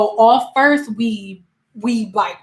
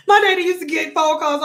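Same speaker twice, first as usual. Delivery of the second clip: ordinary talk, nothing marked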